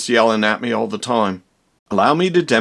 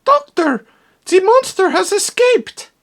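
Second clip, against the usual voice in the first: much higher, strange voice with alarm; high alarmed assistant voice; frantic assistant. Acted. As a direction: cry out the news like a panicky little helper. Assistant announces monster escaped.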